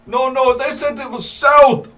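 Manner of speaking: put-on high, loud Jamaican accent. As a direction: giant arguing in Jamaican accent. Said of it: "that's a Jamaican accent".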